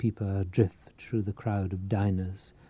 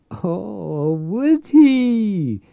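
delighted cackle; 10 semitones higher, 6 dB louder, much slower than usual. A narrator's reading. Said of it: "Maria cackled delightedly".

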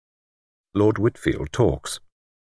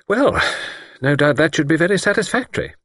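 higher, measured, a hint of a superior doctor; lightly voiced doctor; 10 semitones higher than usual. A narrator's reading. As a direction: play the calm, sceptical doctor lightly. Narrator voices Doctor Thomas.

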